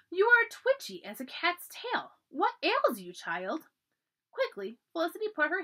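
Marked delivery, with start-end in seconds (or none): 0.1-3.7 put-on higher, louder and slow, a fussy old lady's scolding; lightly voiced fussing matron. A narrator's reading. Fussy lady scolds the girl.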